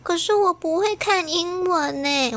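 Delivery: put-on slow, high whiny little girl; kid voice. Little girl telling the sitter she can't read English, whiny. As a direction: drag it out like a child protesting. Child refusing picture books.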